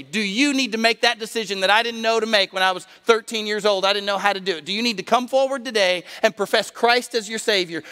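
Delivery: high, pressing preacher's appeal; urgent altar call. Real, not acted. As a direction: plead with the congregation to decide today.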